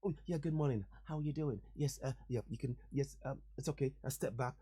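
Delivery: timid shop customer being overly polite; meek placating voice. Retold or imitated. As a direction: make yourself small, soft and low. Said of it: "my accent".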